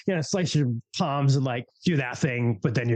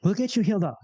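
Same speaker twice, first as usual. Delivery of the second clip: Azrala's voice; 11 semitones higher, faster than usual. Acted. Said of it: "says Azrala".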